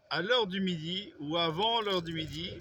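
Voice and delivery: ordinary talk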